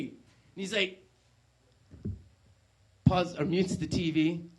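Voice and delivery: normal talking voice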